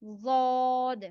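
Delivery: "वाद" very slow, full-mouthed and heavy; heavy model "वाद"; full-mouth demo. Shown as a demonstration. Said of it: "भरे हुए मुंह के साथ".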